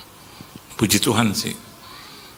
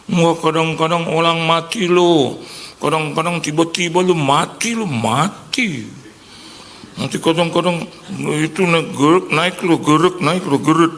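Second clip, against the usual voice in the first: Chinese-Indonesian uncle; 6 semitones higher than usual. Retold or imitated. Friend's uncle warns him to get checkups, in a Chinese-Indonesian accent. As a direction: lecture him like a fussy old uncle. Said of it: "Si engkau bilang".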